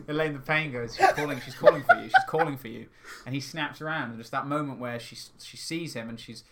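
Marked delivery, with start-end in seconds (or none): 1.0-2.9 imitated calling out, much louder, laugh breaking in; loud urgent call. Podcaster quoting a character calling the controller, loud mock call. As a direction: call it out fast, half laughing. Acts out Elaine the Pain calling.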